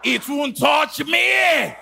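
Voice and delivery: high, loud shouted vow; loud declaration. Shown as a demonstration. Preacher leading the church's declaration, shouted. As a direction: declare it at the top of your voice. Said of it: "Declare it".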